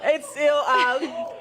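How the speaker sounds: helpless giggling fit; crying with laughter; anchor stifling giggles. Real, not acted. Anchor losing it on air.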